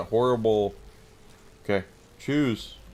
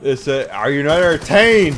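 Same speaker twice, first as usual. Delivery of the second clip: booming arena challenge, much louder and higher; Gladiator shout; 6 semitones higher, 10 dB louder than usual. Retold or imitated. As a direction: bellow it at the crowd. Movie line quoted, then "that's how I felt.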